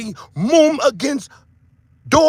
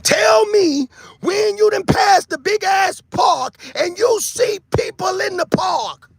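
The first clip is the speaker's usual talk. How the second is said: ranting shout; 10 semitones higher, slower than usual. Real, not acted. Vlogger challenging viewers, high slow shout.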